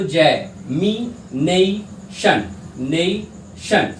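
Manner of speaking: जय, मी, नई, शन each said slowly on its own; separated syllables. Shown as a demonstration. Teacher showing the sounds of examination, जय, मी, नई, शन one by one. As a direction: sound out मी, नई, शन as drawn-out single syllables. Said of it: "आपके sounds आते हैं".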